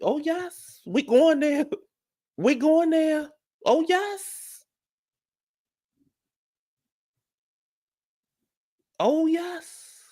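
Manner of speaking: high sing-song oh yes; giddy, high-pitched victory chant; gleeful high cheer. Real, not acted.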